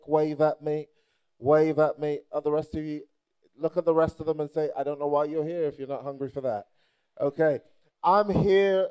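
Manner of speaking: ordinary delivery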